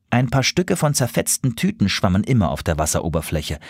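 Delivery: usual speaking voice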